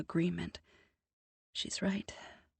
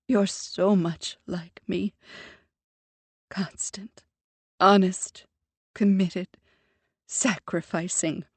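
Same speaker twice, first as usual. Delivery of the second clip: a lightly voiced mother, louder and pleading; a mother breaking down, pouring out regret; emotional mother; 10 dB louder than usual. A narrator's reading. Mother confessing to her daughter, louder and heartfelt.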